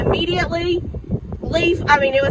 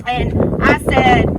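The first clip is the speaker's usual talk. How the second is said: ordinary talk, nothing marked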